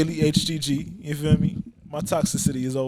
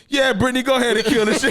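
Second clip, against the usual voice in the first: hype shout; 9 semitones higher, 7 dB louder than usual. Retold or imitated. Hype intro of Brittany.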